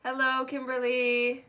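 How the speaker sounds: ordinary talk, nothing marked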